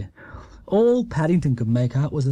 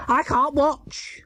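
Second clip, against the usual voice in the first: high, slow, pained; can hardly bear to look; Missus Bird dreading; 10 semitones higher, slower than usual. A narrator's reading. Missus Bird closing her eyes as Paddington races on, dreading. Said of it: "said Missus Bird".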